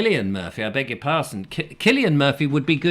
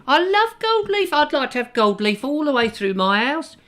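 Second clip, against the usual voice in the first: gushing falsetto character; 11 semitones higher, 3 dB louder than usual. Acted. Enthusing about gold leaf decor, high cartoonish gushing. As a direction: gush in a silly high voice.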